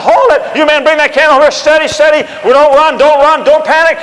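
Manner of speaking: loud, high battlefield commands; rally the panicking men; shouted orders to the troops; commanding shout. Retold or imitated.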